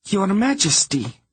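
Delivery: very high, indignant insistence; voice up an octave. A narrator's reading. Princess repeating her title to her father, voice up an octave. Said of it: "her voice going up an octave".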